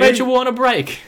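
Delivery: high, loud, hammed British accent; a hammed-up British Ross pleading; mock British Ross. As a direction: shout it like a posh Ross.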